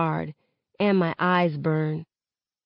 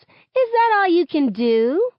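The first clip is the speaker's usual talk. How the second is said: high cocky taunt; 11 semitones higher, 5 dB louder than usual. A narrator's reading. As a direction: goad the other team. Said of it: "Maya taunts".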